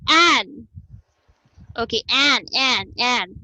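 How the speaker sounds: "an" said distinctly with an American short A; careful pronunciation. Shown as a demonstration. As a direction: model "an" carefully, word by word. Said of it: "make sure that you pronounce the word correctly".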